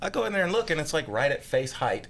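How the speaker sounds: normal talking voice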